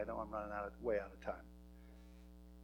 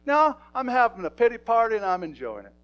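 high, loud mock pout; mimicking his wife; 9 semitones higher, 17 dB louder than usual. Husband imitates wife Hope's pouting reply, high and loud. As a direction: play her sulky pity party, pitched up and louder. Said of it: "I'll tell on Hope".

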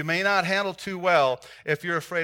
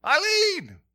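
much higher, louder shouted names; calling into the house, raised voice; 14 semitones higher, 9 dB louder, slower than usual. Retold or imitated. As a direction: yell through the screen door. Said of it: "I was yelling in there".

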